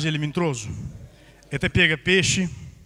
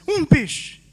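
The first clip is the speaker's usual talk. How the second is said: begging; 8 semitones higher, 4 dB louder than usual. Acted. Fisherman begging for one fish.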